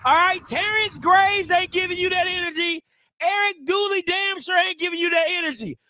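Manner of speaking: high-pitched, forceful hype talk; rally the fans for day one; fired-up hype. Real, not acted. Hypes up day-one energy over song.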